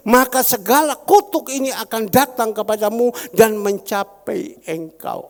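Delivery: loud, ringing pulpit proclamation; a pastor booming scripture at his congregation; preaching at full force. Acted. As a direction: thunder God's promise to the church. Reading scripture loudly.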